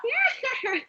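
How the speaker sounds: shrill Crypt Keeper cackle; high cackling laugh. Retold or imitated. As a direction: cackle like a ghoul host.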